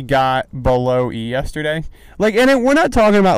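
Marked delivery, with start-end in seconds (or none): 2.2-3.4 excited outburst. Real, not acted. Exclaiming about empty gas tank.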